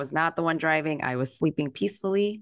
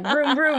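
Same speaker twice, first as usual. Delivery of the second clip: loud, high car engine noise; imitating a speeding car engine, loud and high; engine vroom; 10 semitones higher, 5 dB louder than usual. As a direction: rev it like a race car. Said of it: "I'm like".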